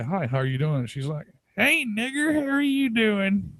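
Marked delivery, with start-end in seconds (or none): 1.6-3.5 put-on very high, drawn-out old-woman voice; old granny. Granny greeting a passer-by in the store, old-woman voice. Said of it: "this old, like, old-ass woman".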